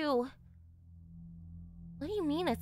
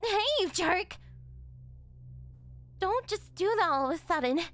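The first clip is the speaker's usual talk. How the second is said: flustered yell; 4 semitones higher than usual. Acted. Flustered girlfriend yells at boyfriend.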